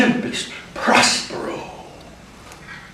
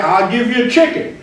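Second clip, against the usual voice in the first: Mister Lutz; 9 semitones higher, 6 dB louder than usual. Acted. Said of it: "Mister Lutz said".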